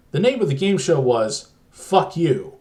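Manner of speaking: ordinary delivery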